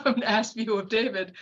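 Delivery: laughing through the corrected words. Real, not acted. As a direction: giggle at your own slip as you say it. Laughs at own slip.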